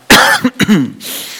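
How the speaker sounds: sharp real cough; a single harsh cough; coughing fit. Real, not acted. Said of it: "ich hab einen Husten".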